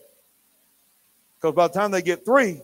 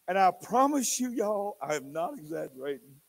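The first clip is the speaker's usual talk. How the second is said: normal talking voice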